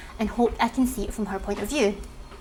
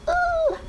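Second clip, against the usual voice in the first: very high-pitched ooh; high little ooh of reaction; intrigued ooh. Real, not acted. Reader reacting to a cut-short date, high intrigued ooh. Reaction to the next post's title.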